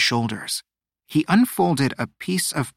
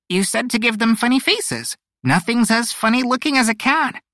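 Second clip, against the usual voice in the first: high-pitched, proudly declaring bird voice; proud announcement; 11 semitones higher than usual. A narrator's reading. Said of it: "she proudly announced".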